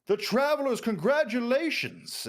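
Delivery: high, loud, slow proclamation to the crowd; mayor's announcement yell. Acted. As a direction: announce the champions over a roaring crowd. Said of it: "and he yells".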